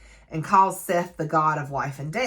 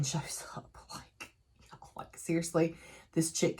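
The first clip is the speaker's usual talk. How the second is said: whispered exasperation; 10 dB quieter than usual. Real, not acted. Exasperated aside about villain.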